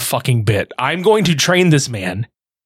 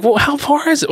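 casual question; 12 semitones higher than usual. Retold or imitated. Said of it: "how me and you normally are".

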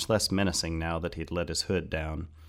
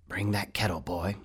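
raspy character voice; 2 semitones higher than usual. A narrator's reading. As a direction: rasp it out as a brief order to a boy. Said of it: "he said in a raspy voice".

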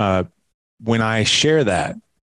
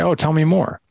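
lifted, gentle tell me more; open invitation; 7 semitones higher than usual. Retold or imitated. Father inviting his son to share more, warm. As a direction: sound like an open door. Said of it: "But if I say".

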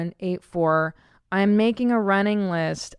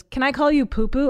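imitating son; 6 semitones higher, 5 dB louder than usual. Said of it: "my son goes".

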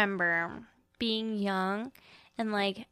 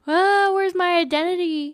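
mock panic; 8 semitones higher, 6 dB louder than usual.

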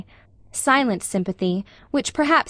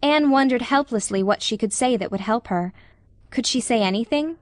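ordinary delivery, nothing marked

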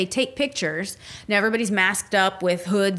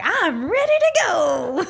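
higher, slower eager squeal; eager mock voice; 9 semitones higher, 4 dB louder, slower than usual. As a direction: pretend to be an eager senior ready for love. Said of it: "I'd be doing the same thing of like".

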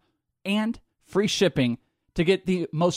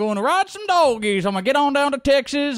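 mock Texas cowboy; 7 semitones higher than usual. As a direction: ham up a yeehawing cowboy. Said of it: "you're like".

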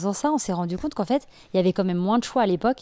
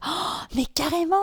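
high, gasped mock delight; hammed-up breathless recognition; gushing fan reaction; 7 semitones higher, slower than usual. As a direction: imitate thrilled listeners remembering. Acts out delighted listeners' reaction.

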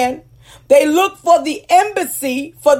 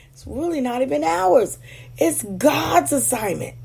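softer; 7 dB quieter than usual. Real, not acted.

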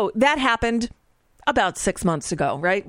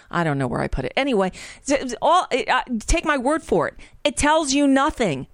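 usual speaking voice

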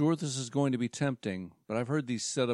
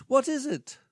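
high, upbeat pitchman voice; salesman voice; 12 semitones higher, 6 dB louder than usual. Acted. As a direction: pitch it like a cheesy presenter. Presenter answers.

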